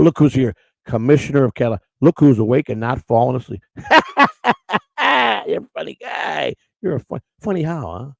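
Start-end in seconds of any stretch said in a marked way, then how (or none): 3.7-5.4 sudden high burst of laughter. Real, not acted. Greeting the commissioner with a high delighted laugh. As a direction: laugh out at the new arrival. Greeting a chatter who joined.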